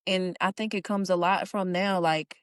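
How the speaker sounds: normal talking voice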